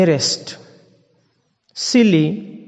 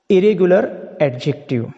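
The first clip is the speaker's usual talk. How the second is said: normal talking voice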